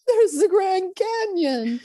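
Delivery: high, slow grand wonder; mock awe. Speaker mocking big spectacular wonder, slow and high. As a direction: gasp it like a big wow moment.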